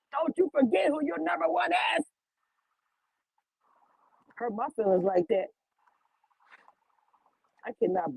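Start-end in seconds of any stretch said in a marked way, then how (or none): 0.1-2.0 higher pitched playful telling-off; hammed-up diva warning; mock scolding. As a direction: scold them half-joking.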